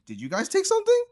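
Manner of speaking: high, loud squeak of disbelief; shocked kid. Retold or imitated. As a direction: shoot up high in alarm. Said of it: "So we're like".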